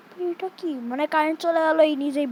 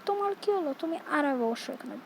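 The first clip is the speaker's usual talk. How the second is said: ordinary talk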